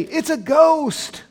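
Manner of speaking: loud, high, drawn-out cry of alarm; cried out in fear. Acted. Disciples seeing Jesus on the water, fearful cry. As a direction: cry it out high in mock terror. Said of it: "they cried out in fear".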